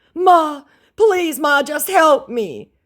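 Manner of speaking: very high, loud pleading whine; begging scammer. Retold or imitated. Scammer begging her in a very high whine. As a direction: beg Ma desperately.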